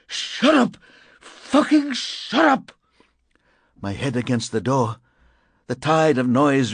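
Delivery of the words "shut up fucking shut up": high, furious screaming; frenzied scream. A narrator's reading. Narrator bloodying knuckles on the door, frenzied scream. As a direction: scream it, stretching the last cry.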